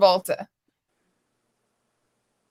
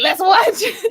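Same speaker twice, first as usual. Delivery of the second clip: eager; 8 semitones higher than usual. Retold or imitated. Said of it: "I'm like".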